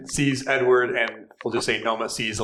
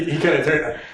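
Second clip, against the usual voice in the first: normal talking voice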